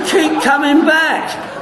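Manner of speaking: high, slow, laughing sing-song; mock greeter, laughing. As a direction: mimic the greeter through laughter.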